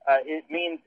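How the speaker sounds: normal talking voice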